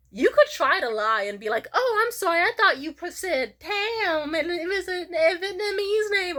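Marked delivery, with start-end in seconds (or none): 1.7-6.4 high, pleading mock apology; fake apology. Lying out of a name blunder, high and apologetic. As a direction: bluff an apology in a high, put-on voice.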